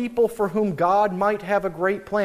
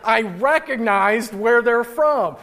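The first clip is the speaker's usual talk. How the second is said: "recognized" hit hard, higher and drawn out; slow, pointed emphasis on "recognized where they're from"; emphatic; 4 semitones higher, 4 dB louder, slower than usual. Shown as a demonstration. Preacher glossing the redundant phrase, emphatic. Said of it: "It's an emphatic way of saying".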